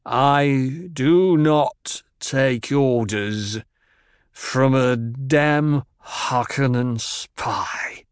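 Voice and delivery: put-on very slow, precise drunken speech; slow careful enunciation. A narrator's reading. Drunk Idaho defying Jessica, slow and over-precise. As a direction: spell out every word slowly. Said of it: "He spoke slowly, with careful and precise enunciation".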